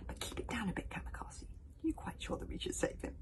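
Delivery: soft unvoiced whisper. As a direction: hush the words. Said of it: "whispered Hiccup".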